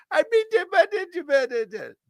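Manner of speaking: very high, put-on squeal; mock squeaky protest. Host answers viewer accusing him of sticking up, mock squeal.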